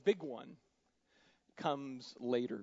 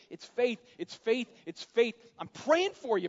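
high, loud urgent insisting; urgent plea; 8 semitones higher, 9 dB louder than usual. Retold or imitated. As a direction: press the word faith home. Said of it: "he's like".

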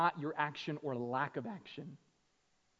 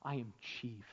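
usual speaking voice

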